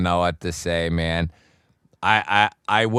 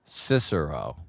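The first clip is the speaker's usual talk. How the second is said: "Cicero" stressed at the start, lower pitch; stress on first syllable; 4 semitones lower, 4 dB quieter than usual. Shown as a demonstration. Streamer apologizing to a viewer, "Cicero" corrected to first-syllable stress. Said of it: "with the accent on the first syllable".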